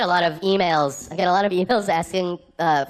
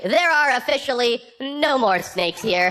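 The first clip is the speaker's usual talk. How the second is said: high, slow mock-official notice; official sign announcement; 7 semitones higher, slower than usual. Comedian reading his last prank sign aloud, mock-official announcement. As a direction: read it out high and measured.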